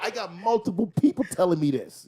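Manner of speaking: high, loud laughing quote; laughing insistence. Retold or imitated. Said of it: "He said".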